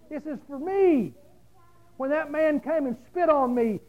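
high, loud, driving preacher's cry; full-force preaching. Acted. Preacher urging the church to love mistreaters, fiery and loud.

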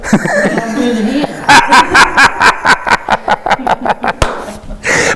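long, rapid run of real laughter. Real, not acted. Preacher joking with congregation about embracing someone difficult, long laugh.

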